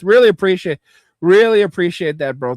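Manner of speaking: excited thanks. Real, not acted. Thanking a donor.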